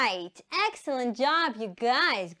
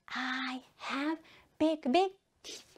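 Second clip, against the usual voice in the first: soft, near-whispered echo; quiet repeat-after-me line; hushed repeat; 5 dB quieter than usual. Shown as a demonstration. Hushed repeat after louder take.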